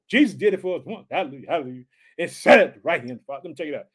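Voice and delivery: ordinary talk, nothing marked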